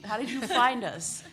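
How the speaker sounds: normal talking voice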